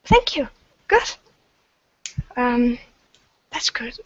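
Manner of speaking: high, tiny squeaky voice; squeaky sprite voice. Acted. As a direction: chirp it like a little sprite. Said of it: "this little squeaky voice".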